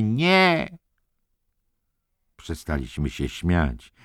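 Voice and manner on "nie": very high-pitched cry of protest; desperate shout. A narrator's reading. Billy shouting at the ceiling light, desperate cry. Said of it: "krzyczał prosto".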